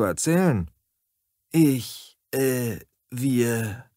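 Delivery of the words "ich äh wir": confused, halting stammer; flustered, searching for words. A narrator's reading. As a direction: stumble hesitantly over the words. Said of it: "die Blonde wirkte verwirrt".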